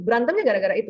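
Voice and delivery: usual speaking voice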